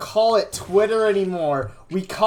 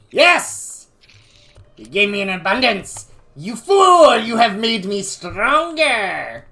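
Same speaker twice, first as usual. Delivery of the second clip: gloating villain; 3 semitones higher, 8 dB louder than usual. Acted. Cartoon villain gloating at card draw.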